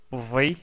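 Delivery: "vi" with a short i, said firmly; Arabic with kasra. Shown as a demonstration. Teacher voweling the letter with kasra for the class. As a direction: close the vowel on "vi". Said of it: "avec la kasra".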